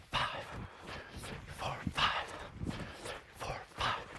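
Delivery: breathy whispered count in rhythm. Shown as a demonstration. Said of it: "Do you know you're on a five count".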